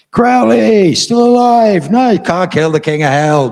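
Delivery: high, loud joyful cheer; cheering fans. Retold or imitated. EMTs greeting him back at hospital, loud high cheering. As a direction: hail him like a returning hero. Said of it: "this massive love fest of".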